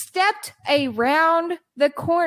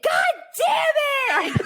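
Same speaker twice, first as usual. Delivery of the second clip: high, drawn-out comic wail of frustration; hammed-up cry of outrage; anguished shout; 9 semitones higher, much slower than usual. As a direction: yell it slowly, pitch way up, breaking into laughter. Retold outburst at closed restaurant.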